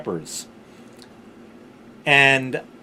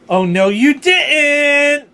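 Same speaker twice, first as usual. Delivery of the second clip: high, slow, drawn-out sassy taunt; sassy body voice; 12 semitones higher, 7 dB louder, much slower than usual. Acted. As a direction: voice your own body scolding you, much higher. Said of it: "my body said".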